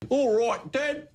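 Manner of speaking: put-on teen copying dad's Australian accent; son's Australian impersonation. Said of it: "he does the impersonation".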